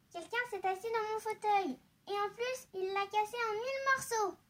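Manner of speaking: put-on high-pitched baby bear; little baby voice. A narrator's reading. Baby bear with broken chair, high baby voice. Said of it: "dit de sa petite voix".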